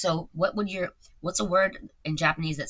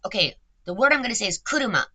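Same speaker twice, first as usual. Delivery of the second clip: insistent repeat; 2 semitones higher, 7 dB louder, faster than usual. Real, not acted. Player repeating her word to the cheating AI, fast and insistent. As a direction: say it again, faster and louder, losing patience. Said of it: "You need to think of it first".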